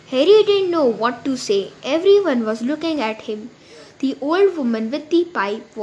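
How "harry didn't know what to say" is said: plain reading voice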